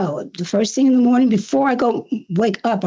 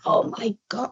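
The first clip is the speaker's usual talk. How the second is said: softly retold amazed reaction; retold awe; 6 dB quieter than usual. Guest recalls reaction to [name] at her door, soft awe. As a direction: say it softly, stunned. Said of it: "but I said".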